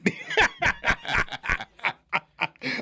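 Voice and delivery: long, high-pitched laughter; hearty laugh. Real, not acted. Host laughing after muddling the game, long high laugh. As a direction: burst out laughing at yourself.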